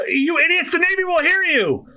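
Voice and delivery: high-pitched exasperated scolding; angry scolding. Acted. Character scolds reckless comrades.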